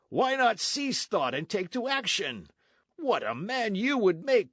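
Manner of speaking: hearty soldier. Acted. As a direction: rouse a lazy friend to fight.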